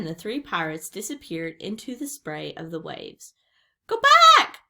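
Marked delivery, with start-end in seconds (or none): 3.9-4.6 very high, loud parrot screech; squawking parrot. A narrator's reading. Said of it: "squawked Polly".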